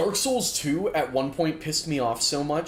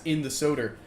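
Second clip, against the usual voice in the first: ordinary delivery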